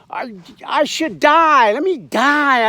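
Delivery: hammed-up self-pitying prophet; whiny Jonah. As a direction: sulk like a spoiled child begging to die. Jonah sulking, wishing to die.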